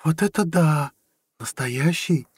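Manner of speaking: high, awed woman's voice; amazed. A narrator's reading. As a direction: marvel at a thrilling present. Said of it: "только и вырвалось у неё". (восхищённо, высоким голосом)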